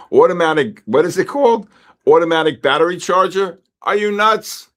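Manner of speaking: loud, high, exploding in disbelief; incredulous outburst. Real, not acted. To David about his battery charger, loud incredulous outburst. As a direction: blurt it out, half laughing, can't believe it. Reacting to a posted product.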